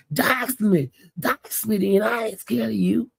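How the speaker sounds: high, slurred imitation of a drunk taunt; mock drunk dare. Cupcake drunkenly daring eighty-eight, imitated high and slurred. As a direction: dare him in a put-on high drawl.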